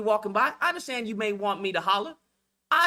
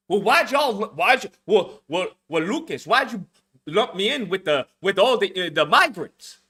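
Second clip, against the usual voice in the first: mocking stammered complaint; 3 semitones lower, 4 dB louder than usual. Mimicking a complaining commenter.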